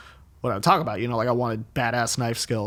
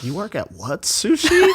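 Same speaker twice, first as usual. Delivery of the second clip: incredulous; 8 semitones higher, 3 dB louder, slower than usual. Retold or imitated. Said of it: "Filipino people are like".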